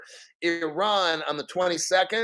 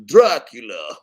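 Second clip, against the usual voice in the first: hammed-up vampire, louder; Dracula voice; 6 semitones lower, 8 dB louder than usual. Host answers a chat comment with a mock Dracula. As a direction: ham up the name like a campy Count. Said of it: "Sounds like Dracula".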